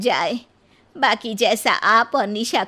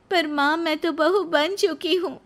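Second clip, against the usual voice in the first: put-on higher, pleading young voice; protesting Nisha; 8 semitones higher than usual. Nisha reminding mother-in-law she is a bride, pleading. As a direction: gently insist.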